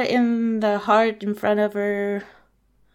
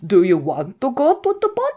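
lightly put-on old-woman accent; old lady; 3 semitones higher, 4 dB louder than usual. Old lady offering Cinderella the ball, lightly put-on accent. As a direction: ask it in an uncertain character accent. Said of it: "don't know her accent".

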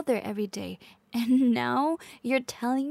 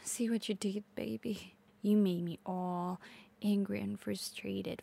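subdued reproach; 2 semitones lower, 7 dB quieter than usual. Acted. Girlfriend blaming partner for her anger, hushed reproach. Acted scolding after the outburst.

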